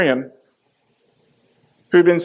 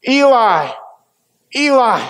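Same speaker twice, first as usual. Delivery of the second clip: higher, louder anguished cry; 5 semitones higher, slower than usual. Acted. Jesus crying to God on the cross, higher, louder anguished cry. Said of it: "cried out in a loud voice".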